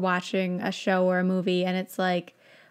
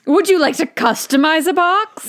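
louder and higher eager offer; excited wizard; 7 semitones higher, 9 dB louder than usual. Acted. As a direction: pitch the custom box eagerly. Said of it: "very excited from the beginning".

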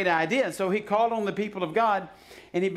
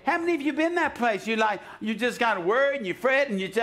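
ordinary delivery

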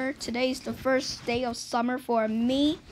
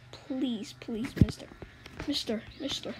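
soft unvoiced pleas of mister; whispered pleading; 3 semitones lower, 6 dB quieter than usual. Gamer begging the cop, whispered pleas. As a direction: whisper the begging under your breath.

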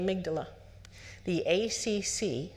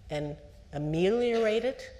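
ordinary delivery

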